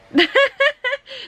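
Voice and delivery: high-pitched burst of laughter; amused laugh. Real, not acted. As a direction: laugh at your own silly accent. Laughs at own put-on accent.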